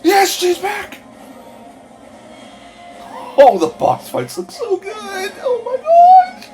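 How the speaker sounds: very high squealing shout; overexcited babbling. Real, not acted. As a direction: scream with joy, slowly. Screams at trailer.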